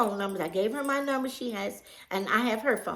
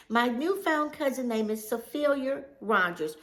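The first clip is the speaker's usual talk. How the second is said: ordinary talk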